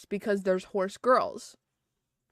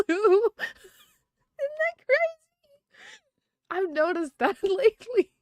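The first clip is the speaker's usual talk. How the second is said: laughing; 6 dB louder than usual. Real, not acted. Laughing about cowboy-hat anime Americans.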